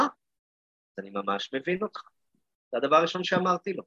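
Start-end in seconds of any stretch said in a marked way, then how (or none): 0.0-2.0 put-on slow, soft and low, calming the boy down; gentle understanding. Shown as a demonstration. Teacher to angry boy, slow soft understanding. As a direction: ease the child's anger with quiet empathy. Teacher models empathic reply to child.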